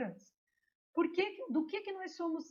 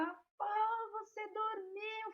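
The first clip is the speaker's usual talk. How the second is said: high, soft mock outrage with drawn-out words; mock scolding; 9 semitones higher, 5 dB quieter, much slower than usual. Shiva catches his wife asleep, mock scolding. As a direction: play an indignant husband without raising volume. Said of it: "fica mó bravo com ela".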